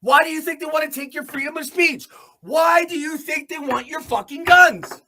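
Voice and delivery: normal talking voice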